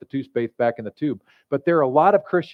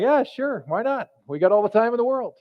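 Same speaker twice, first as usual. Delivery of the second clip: easygoing new arrivals; 8 semitones higher than usual. Acted. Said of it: "And they're like".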